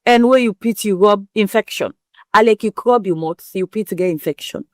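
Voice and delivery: ordinary delivery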